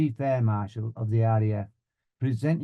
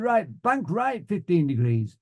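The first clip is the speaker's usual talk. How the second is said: high, slow flying order; instructor's command; 6 semitones higher, slower than usual. Retold or imitated. Flying instructor ordering a turn, instructor's command. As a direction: call it out deliberately, falling at the end.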